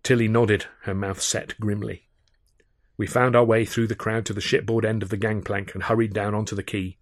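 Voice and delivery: ordinary delivery, nothing marked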